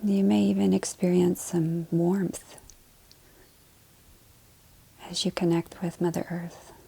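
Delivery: calm body-scan voice, short phrases between long silences; guided meditation. Acted. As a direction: lead them gently through the body, leaving space to breathe.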